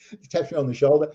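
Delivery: telling it through laughter; real chuckle breaking into the story; laughing. Real, not acted. Laughing while telling the prank.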